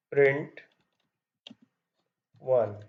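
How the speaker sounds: usual speaking voice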